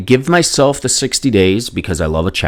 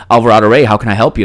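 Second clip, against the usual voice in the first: receptionist's intercom greeting, a bit louder and higher; lightly voiced secretary; 5 semitones higher, 6 dB louder, faster than usual. Secretary greets a caller over the intercom, lightly imitated. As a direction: lightly play a secretary answering. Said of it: "the secretary answers on the intercom".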